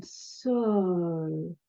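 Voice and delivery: drawn-out, slow voice; dragging slow-motion voice. Shown as a demonstration. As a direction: stretch each word out lazily. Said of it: "you can slow it down".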